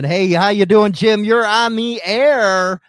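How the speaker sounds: host hailing a caller on the air, loud and high; big on-air greeting. Real, not acted. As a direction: throw the caller a loud, bright hello as the host.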